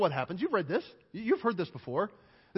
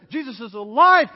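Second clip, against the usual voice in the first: excited insistence; 8 dB louder than usual. Acted. Women insisting to disciples.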